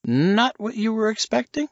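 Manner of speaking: high, probing character voice; sly teasing question; knowing Silas. Acted. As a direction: needle Nichols with a question.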